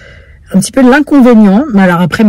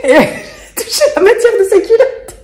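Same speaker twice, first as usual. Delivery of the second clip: higher, words broken by laughter; laughing; 9 semitones higher than usual. Real, not acted. Retelling touching the midwife's underwear, laughing higher. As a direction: giggle through the memory.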